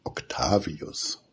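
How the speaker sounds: usual speaking voice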